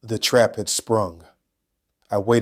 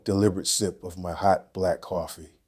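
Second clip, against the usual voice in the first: plain reading voice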